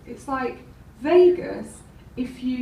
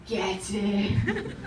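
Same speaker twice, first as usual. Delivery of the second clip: imitated catchphrase; 3 semitones lower than usual. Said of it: "She gets home from work".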